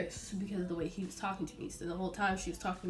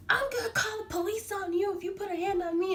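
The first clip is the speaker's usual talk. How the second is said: high, loud mock threat; threatening customer; 12 semitones higher, 4 dB louder than usual. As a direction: threaten to call the police.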